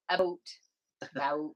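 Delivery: tight, rounded vowel in "about"; Canadian about. Shown as a demonstration. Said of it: "hide their accent really well".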